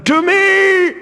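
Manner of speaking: very high, loud call to arms; shouting for help. Acted. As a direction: bellow for the other guards. Guard calling for help.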